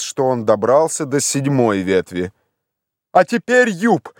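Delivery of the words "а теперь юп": high, urgent call upward; agitated, high-pitched order; wildly excited shout. A narrator's reading. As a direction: shout up, beside yourself with excitement. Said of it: "закричал Легран вне себя от волнения".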